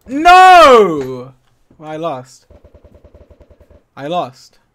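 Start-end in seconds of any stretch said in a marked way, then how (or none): 0.1-1.3 long, high wail of defeat; anguished shout. Real, not acted. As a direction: scream a drawn-out no at losing. Lost the game.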